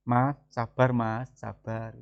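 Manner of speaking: soft, slow pleading little sibling; gently calm the older brother down; younger sibling pleading. Acted. Little brother softly begging his big brother to be patient.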